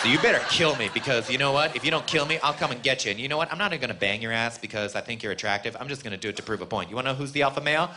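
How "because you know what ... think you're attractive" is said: ordinary talk, nothing marked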